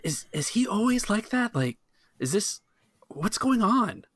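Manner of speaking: put-on high, anxious young waiter voice; a nervous waiter whispering a worried question. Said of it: "he's asking you, you know, like".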